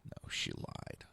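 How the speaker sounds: hushed, slow correction of "lied"; whispered. Shown as a demonstration. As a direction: whisper "No, she lied." slowly, voiceless. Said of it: "She misled".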